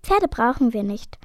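ordinary delivery

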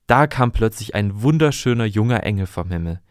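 ordinary delivery

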